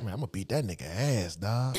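low, soft, quick mumbled threat; hushed, never-mad smooth guy; Tizzy. Retold or imitated. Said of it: "I remember Tizzy was like".

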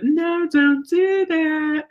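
high, slow singsong no; mock soft-hearted scolding. Trainer shows the tone she avoids with dogs, mock singsong scolding. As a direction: scold in a drawn-out, singsong lilt. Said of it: "That's not the tone of voice I use".

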